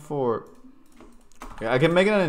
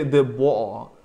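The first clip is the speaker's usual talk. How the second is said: British "water" with the T dropped; British accent; 6 dB louder than usual. Shown as a demonstration. Streamer showing the one word he can manage, British accent. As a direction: say "water" like a loud Brit. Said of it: "I can only say the".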